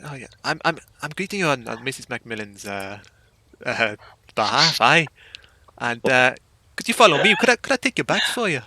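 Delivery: put-on Scottish accent. Butler offering to carry bags, light Scottish accent. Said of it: "I'm gonna have to do a Scottish accent".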